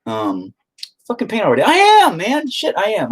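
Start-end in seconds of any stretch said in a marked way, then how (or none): 1.1-3.1 high, loud whiny protest; mock exasperated outburst. Answering a demand to start painting, mock exasperated. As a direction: protest it in a squealing burst.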